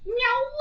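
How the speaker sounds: cat meow. Pretend. Calling the cat.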